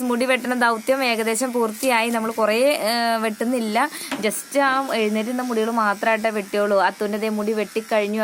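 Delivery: usual speaking voice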